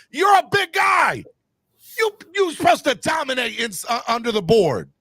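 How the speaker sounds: loud, high angry yelling; angry rant. Real, not acted. Fan yelling at Anthony Davis over rebounds, angry. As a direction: chew him out at full volume.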